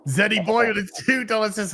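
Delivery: usual speaking voice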